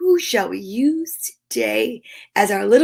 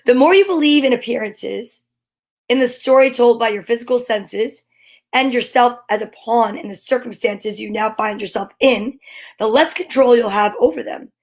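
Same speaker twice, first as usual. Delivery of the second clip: measured, earnest reading of a daily quote; solemn quote reading; 4 dB louder than usual. Acted. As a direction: read it like a meaningful message. Reads the daily quote aloud.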